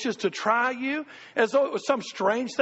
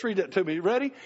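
usual speaking voice

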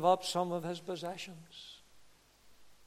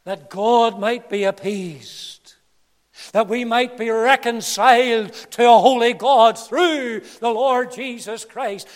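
loud, drawn-out pulpit proclamation; full-force preaching; 4 semitones higher, 17 dB louder, slower than usual. Acted. Preacher proclaiming reconciliation to God, full-force preaching. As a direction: swell strongly on the key words.

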